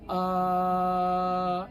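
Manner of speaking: आ held long from the throat; throat sound demo. Shown as a demonstration. Teacher demonstrating to students a long throaty आ. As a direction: sustain आ low from the throat. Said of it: "कंठ से आवाज कैसे उत्पन्न होती है पता है?".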